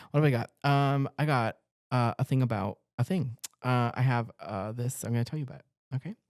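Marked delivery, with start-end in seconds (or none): none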